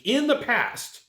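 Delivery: hammed-up courtroom question; courtroom lawyer. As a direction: grill them like a trial lawyer. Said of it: "It's like a court of law".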